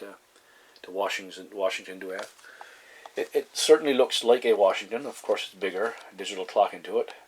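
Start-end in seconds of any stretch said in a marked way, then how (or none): none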